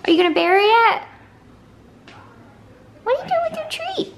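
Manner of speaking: high-pitched questioning of a pup; pet-talk voice. Real, not acted. Owner asking her dog about its treat, pet-talk voice. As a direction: quiz the dog in a voice that climbs very high.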